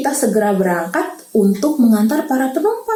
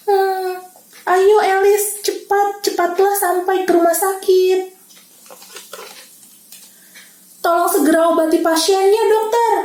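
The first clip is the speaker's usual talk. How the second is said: urging; 7 semitones higher than usual. Acted. Urging Elis to hurry.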